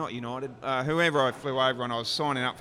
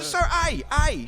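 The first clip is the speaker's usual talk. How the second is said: very high, loud repeated "A"; exasperated insisting; 8 dB louder than usual. Retold or imitated. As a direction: argue the letter at the top of your range. Retold argument over the letter.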